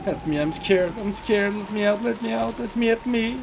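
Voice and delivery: high, frantic pleading for help; terrified pleading. Acted. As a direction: beg to be let out, panicking. Panicking to get out.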